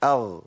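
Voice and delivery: "أغ" with the ghayn left to fade out by itself; natural-length ghayn. Shown as a demonstration. Teacher showing students the letter's natural timing, natural-length ghayn. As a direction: let the sound of "أغ" end on its own. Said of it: "اسمع مني كده".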